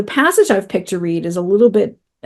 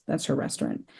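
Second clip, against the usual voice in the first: usual speaking voice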